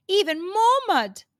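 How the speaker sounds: plain reading voice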